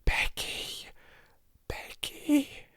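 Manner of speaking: urgent breathy stage whisper; loud whisper. A narrator's reading. Girl waking Becky at her bedside, loud whisper. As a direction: whisper as loudly as you dare. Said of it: "she whispered as loudly as she dared".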